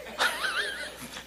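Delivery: high breathy chuckle; laughing. Real, not acted. Pastor admitting he prepared it, laughing. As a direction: laugh at your own confession. Laughs at his own confession.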